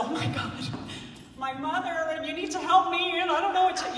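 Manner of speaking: mimicking a helpless meltdown; mock overwrought. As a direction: pretend to fall apart in front of someone. Mimics falling apart before patient.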